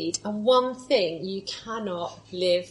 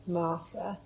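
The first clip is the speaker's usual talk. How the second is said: soft, rising; 3 semitones lower, 8 dB quieter than usual. Shown as a demonstration. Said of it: "Put your name there".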